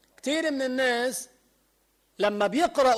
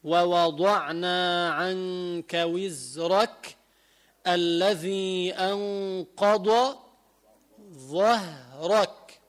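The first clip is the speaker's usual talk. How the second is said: slow, drawn-out tajweed chant; تلاوة مجودة بطيئة; Quranic recitation; 2 semitones lower, much slower than usual. Acted. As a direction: recite the verse slowly like tajweed.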